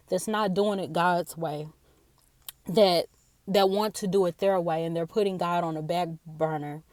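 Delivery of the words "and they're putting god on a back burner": ordinary talk, nothing marked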